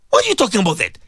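ordinary talk, nothing marked

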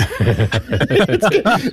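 a real burst of laughter at his own joke; amused laugh. Real, not acted. Host laughing at his dinner-argument example, amused laugh.

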